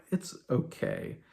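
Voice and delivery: slow, whispered, unimpressed; telling fans who rave about the musical that it's merely okay, whispered; whispered shrug. Retold or imitated. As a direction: deflate the hype with a whispered verdict. Dismissive verdict on music.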